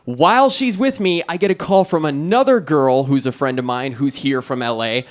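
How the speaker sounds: normal talking voice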